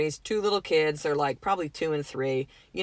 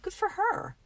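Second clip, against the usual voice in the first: mock bright; 14 semitones higher, slower than usual. Retold reaction to rival's success.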